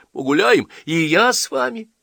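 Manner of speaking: high, loud eager call; child's cry. A narrator's reading. Girl begs to come along on the walk, crying out. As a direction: pipe up loudly like a little girl. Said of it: "закричала Ася".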